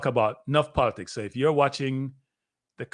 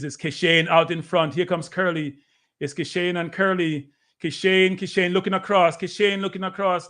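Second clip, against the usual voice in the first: rising, excited play-by-play at a higher pitch; live race call; 4 semitones higher than usual. Acted. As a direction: call the sprint as it happens, building.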